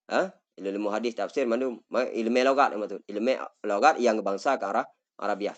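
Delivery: ordinary talk, nothing marked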